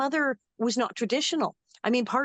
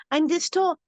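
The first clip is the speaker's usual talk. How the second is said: put-on little girl voice; 5 semitones higher than usual. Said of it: "the first thing she said is she said".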